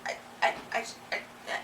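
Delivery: whispered, trailing helpless stammer; unvoiced, fading stammer. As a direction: stammer in a whisper with dirty hands. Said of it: "you're like".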